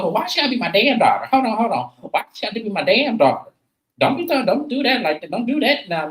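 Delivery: high-pitched, quick-fire acted protest; retold argument, pitched well up; indignant objection. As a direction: imitate an offended mother cutting someone off. Acted quarrel over "damn daughter.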